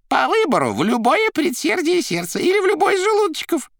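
very high, loud character voice; Koroviev boasting. A narrator's reading. Koroviev boasting of Azazello's aim, high proclamation.

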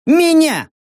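high, loud indignant cry; indignant outburst. A narrator's reading. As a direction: burst out offended.

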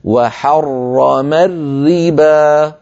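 very slow chant, thick r in حرم, thin r in الربا; drawn-out recitation contrasting both r sounds of وحرم الربا; heavy then light r. Shown as a demonstration. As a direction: hold each doubled r, dark in حرم, light in الربا. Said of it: "ففخموا المفخم ورققوا المرقق".

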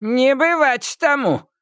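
put-on high, sharp old witch retort; indignant witch. A narrator's reading. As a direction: flare up like Baba Yaga. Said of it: "вскинулась Яга". (Яга вскинулась)

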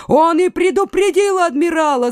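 high-pitched accusing shout; soldiers' cry. A narrator's reading. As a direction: raise the alarm in a high voice. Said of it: "закричали несколько солдат".

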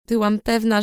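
ordinary delivery

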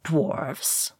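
soft, exasperated mutter; under his breath. A narrator's reading. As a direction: sigh it to yourself. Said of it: "said Gandalf under his breath".

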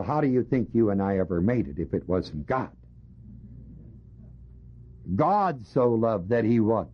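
ordinary delivery

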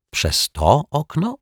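high, slow stunned question; stammered out. Acted. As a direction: choke out the words in disbelief. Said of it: "wykrztusił detektyw Pozytywka". (wykrztuszone ze zdumienia)

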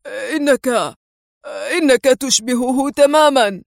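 halting, amazed stammer; faltering with awe; stunned amazement. A narrator's reading. The man staring at the disguise, amazed and stammering. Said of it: "في ذهول".

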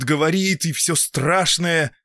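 plain reading voice

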